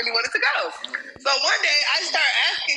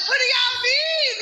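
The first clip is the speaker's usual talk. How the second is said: high slow exasperated cry; 10 semitones higher than usual. Retold or imitated. Retelling how she begged her friends, high and exasperated. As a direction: pitch shoots up, stretched out in disbelief. Her own retold outcry.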